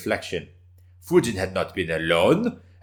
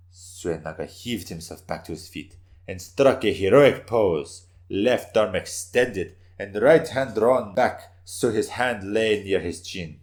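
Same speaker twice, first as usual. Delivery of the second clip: usual speaking voice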